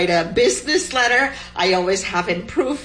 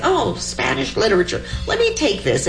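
breezy; 6 semitones higher, faster than usual. Retold or imitated. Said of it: "And I said".

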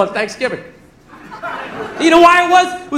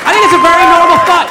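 louder, fast blurt; 4 semitones higher, 7 dB louder, faster than usual. Shown as a demonstration. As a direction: rush it out loud, half-joking. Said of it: "first take, then restated calmly".

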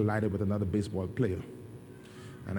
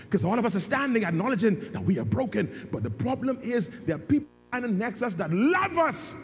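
rising, louder preacher's drive; preaching build; 10 semitones higher, 4 dB louder than usual. Acted. Preacher telling the church that loved ones stand near, rising. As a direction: push the sermon up to full force.

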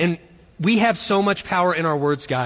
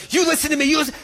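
stern mom warning; 9 semitones higher, much faster than usual. Retold or imitated. Scolding son, friends approaching.

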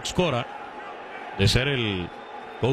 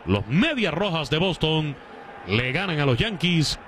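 raised, projected baseball announcer voice; play-by-play recap; 5 semitones higher than usual. Real, not acted. Announcer closing the top of the sixth for listeners, play-by-play recap. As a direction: wrap up the inning with lifted pitch. Sums up the inning on air.

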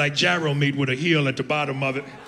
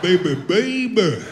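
slow, strained caricature voice; hammed-up throaty noise voice; mock throat noise; 3 semitones higher, 3 dB louder, much slower than usual. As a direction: drag the words out through a put-on choke. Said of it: "making all them noises".

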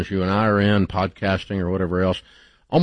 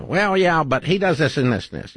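higher-pitched imitation of a gossip; mock gossip; 8 semitones higher than usual. Host mimicking friends who criticize others, mock gossip. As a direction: imitate someone eagerly finding fault.

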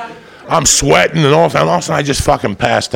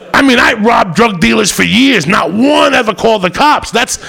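ranting shout; 9 semitones higher than usual. Acted. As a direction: yell it out at the crowd.

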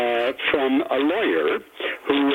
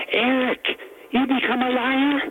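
a hint of an Irish accent, pitched up; lightly voiced Irish old man asking; Irish granddad; 10 semitones higher than usual. Grandfather on the porch teasing his grandson, light Irish accent. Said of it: "who's got some Irish accent in him".

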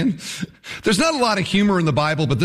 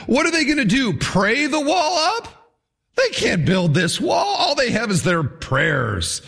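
ridiculing taunt; 7 semitones higher than usual. Acted. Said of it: "He ridicules their optimism".

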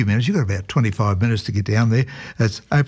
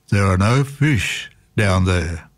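lower, slow mock-booming voice from above; 4 semitones lower, slower than usual. A narrator's reading. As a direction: boom it out slowly like a voice from nowhere. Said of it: "a voice boomed out".

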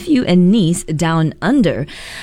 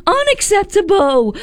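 high, scolding Singaporean mom voice; nagging mother scolding her kid. Retold or imitated. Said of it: "Let me try".

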